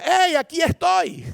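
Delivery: high, drawn-out calling out; mock shout, stretched and high; acted attention-seeking shout. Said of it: "El liderazgo servicial no grita".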